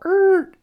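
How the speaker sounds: loud, high screech noise; imitated screeching halt. Man halting his own rant mid-story, loud imitated screech. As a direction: mimic brakes screeching to a stop.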